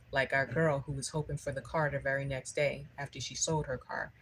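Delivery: ordinary talk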